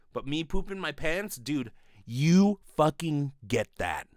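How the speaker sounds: usual speaking voice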